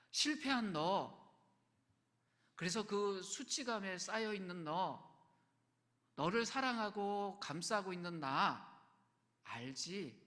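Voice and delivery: slow, deliberate, reassuring words; tender reassurance. Pretend. The Lord speaking to a failed disciple, slow and tender. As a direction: lean in and hold each phrase.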